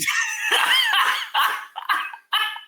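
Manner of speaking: high laughing shout; shrieking laugh. Real, not acted. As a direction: howl with laughter. Laughs at his own lie.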